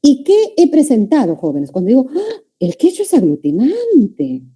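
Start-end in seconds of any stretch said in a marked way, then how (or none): 2.1-4.4 a sudden high gasp of surprise, then the sentence; surprised ah. Shown as a demonstration. Teacher demos surprise to students: high surprised "¡Ah!". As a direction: act amazed at the news. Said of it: "Quizás no dije, "¡Ah!", ¿no?".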